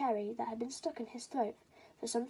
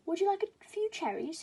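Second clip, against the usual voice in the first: higher, sweet coaxing question; coaxing offer; 8 semitones higher, 3 dB louder than usual. Acted. Father crow tempting his son, coaxing. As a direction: tempt him with cherries.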